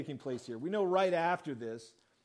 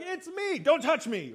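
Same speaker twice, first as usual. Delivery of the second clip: mock prissy voice, much higher and louder; mock huffy; 9 semitones higher, 6 dB louder than usual. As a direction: play it as a silly, fussy misreading. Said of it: "Jesus is like".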